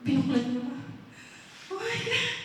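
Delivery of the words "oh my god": high-pitched gasp of disbelief; acted shock. Man seeing his secrets recorded, shocked. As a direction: exclaim it high, as if just discovering it.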